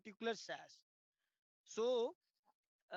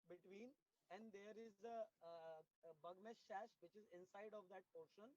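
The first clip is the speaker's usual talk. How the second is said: very faint, far from the mic; barely audible; 13 dB quieter than usual. Real, not acted. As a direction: voice too low to hear. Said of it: "Your voice is, uh, very low".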